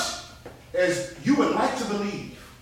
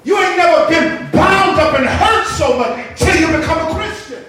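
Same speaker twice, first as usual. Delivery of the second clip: raised preacher's voice, pressing each phrase; full-force preaching; 7 semitones higher, 9 dB louder than usual. Real, not acted. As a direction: preach it out to the whole congregation.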